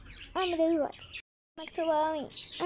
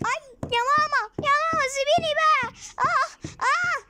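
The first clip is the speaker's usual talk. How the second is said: much higher, louder pained crying; 13 semitones higher, 5 dB louder than usual. Acted. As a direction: wail as mom grabs you. Girl being hit by mom. (بتصرخ وبتعيط)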